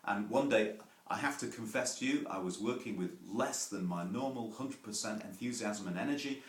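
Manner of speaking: usual speaking voice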